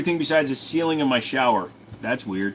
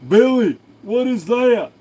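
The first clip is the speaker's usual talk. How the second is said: much higher, louder calling voice; calling out to Billy; 10 semitones higher, 7 dB louder, slower than usual. Acted. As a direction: shout across to Billy in a put-on voice.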